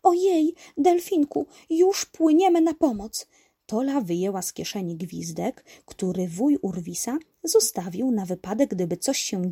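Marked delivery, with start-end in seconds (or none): 0.0-3.3 high character voice, louder; little heroine rushing to help; concerned little helper. Acted. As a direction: answer the dolphin in a high voice. Tola answers the frightened dolphin.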